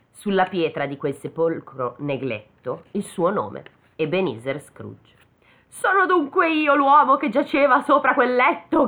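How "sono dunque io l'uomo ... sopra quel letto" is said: high, loud cry of horror at his own grave; anguished cry. A narrator's reading. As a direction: cry it out as he falls to his knees. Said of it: "gridò cadendo in ginocchio".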